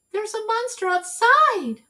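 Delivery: high, breathless worry; frightened. Acted. Baby telling Eugenia about the monster, scared. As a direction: tell her sister in fright. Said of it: "said Baby".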